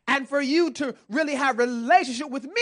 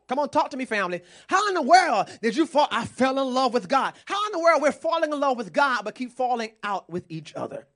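ordinary delivery

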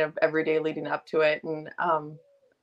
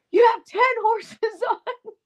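high squeaky incredulous question with a laugh; shocked disbelief; 5 dB louder than usual. Retold or imitated. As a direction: sound floored by the number, laughing. Brandon shocked by ten horses.

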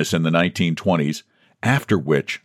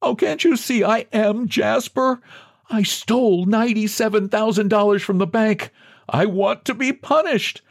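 very high-pitched desperate pleading; high, piteous plea that keeps pressing on; pitiful begging. A narrator's reading. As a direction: beg pitifully, pitch far up. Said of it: "He begged pitifully".

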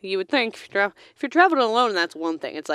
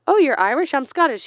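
bright louder higher greeting, Oh, you're Irish; chirpy stranger; 6 semitones higher, 4 dB louder than usual. Retold or imitated. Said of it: "they're like".

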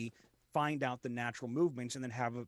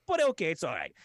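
ordinary talk

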